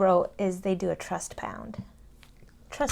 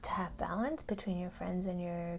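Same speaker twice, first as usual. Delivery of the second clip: soft unvoiced whisper; 3 semitones lower, 11 dB quieter than usual. Real, not acted. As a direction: breathe it as a secret. Whispered joke about lesson.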